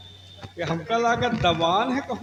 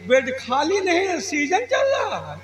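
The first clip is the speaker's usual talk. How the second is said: laughing disbelief; 8 semitones higher than usual. Acted. Incredulous laughing repeat.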